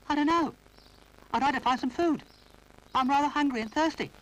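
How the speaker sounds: put-on high, timid little boy voice; Noddy's high childish voice; little toy boy. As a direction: apologise like a small toy.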